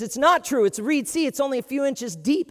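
a light touch of a lecturing teacher; mock teacher. Teacher corrects the kid.

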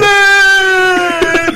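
high, loud scream for mom; mock terrified shout of Anne!; mock panicked shout. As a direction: yell for your mother as the place blows up. Panicked cry from burning taxi stand.

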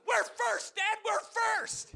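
put-on high, loud gleeful kid shouting; imitating excited child. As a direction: yell it like a thrilled little boy. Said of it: "he's excited".

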